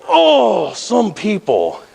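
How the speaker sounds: exasperated but keeping it polite, drawn out; restrained exasperation. Retold or imitated. Driver watching his language before passenger, restrained exasperation.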